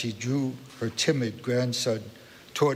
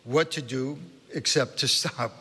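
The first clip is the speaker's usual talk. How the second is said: ordinary delivery, nothing marked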